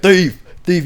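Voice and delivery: high, loud teasing yell of thief; mock screaming. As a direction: shout it out as a prank accusation. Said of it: "He was screaming that shit as loud as he could".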